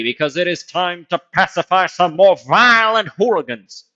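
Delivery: hammed-up announcer slipping into Southern; accidental Southern accent. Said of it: "I don't know why I went southern there".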